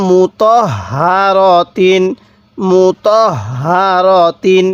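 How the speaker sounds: মুতহারাতিন drawn out very slowly, every syllable held; slow demonstration. Shown as a demonstration. Teacher showing students how to read it, very slow. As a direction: spell out মুতহারাতিন slowly for a learner. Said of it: "তাহলে কিভাবে পড়ব?".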